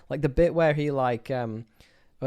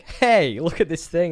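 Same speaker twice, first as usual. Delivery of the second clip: excited; 5 semitones higher, 6 dB louder, slower than usual. Retold or imitated. Mock scientist quote.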